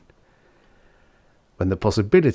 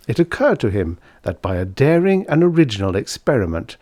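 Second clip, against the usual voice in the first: ordinary delivery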